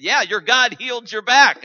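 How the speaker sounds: high, loud, overjoyed retelling; excited declaration. Retold or imitated. Preacher telling the healed man, joyfully loud. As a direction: belt out the good news.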